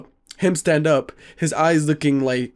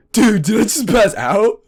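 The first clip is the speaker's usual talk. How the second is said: louder, higher amazed question; retold giddy waking; 6 semitones higher, 5 dB louder than usual. Friend waking up asks the narrator, giddy amazement. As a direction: ask it loud and giddy with a smile. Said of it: "with a freaking smile".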